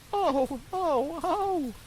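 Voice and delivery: high, loud puppet wails rising to the top; loud, high anguished oh oh oh; wailing cry. Acted. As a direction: cry out in misery. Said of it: "began to scream and cry so loudly".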